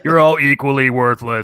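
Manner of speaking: slow, loud drill sergeant bark; drill instructor voice. Retold or imitated. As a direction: chew them out like a DI. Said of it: "talking in a drill instructor voice".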